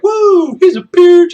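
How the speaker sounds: high, loud jeer; whooped shout; another man's whoop. Acted. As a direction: holler it from the ranks. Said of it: "And another man's like".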